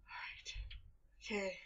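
tense whisper. Real, not acted. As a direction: breathe it nervously. Whisper before danger.

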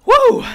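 very high, loud woo; excited whoop. Real, not acted. Player reacting to the crate opening, high loud whoop. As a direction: burst out with a sudden whoop.

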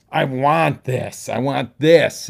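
loud, slow, driving insistence; determined demand. Shown as a demonstration. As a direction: pound it out like you mean it. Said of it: "and know what you want".

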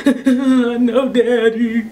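loud mock blubbering with a laugh; imitated crying. Crying daughter answering her daddy, hammed-up sobbing. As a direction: whimper it like a sobbing kid, hammed up. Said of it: "I'm crying and snot running".

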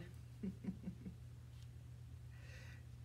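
soft breathy chuckle; quiet laugh. Real, not acted. Teacher amused after shouting the rhyme, soft chuckle. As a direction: chuckle lightly under your breath.

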